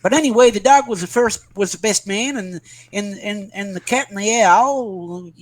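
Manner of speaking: a Southern US storyteller, hammed up, slowing down; Southern American accent. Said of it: "Can you do the American accent?".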